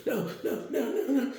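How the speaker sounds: rapid-fire high-pitched pleading; mock panic. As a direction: play someone about to be dropped. Retold victim about to fall.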